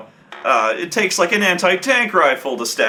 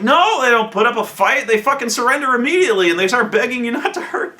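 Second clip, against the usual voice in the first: amused correction; 8 semitones higher than usual. Retold or imitated.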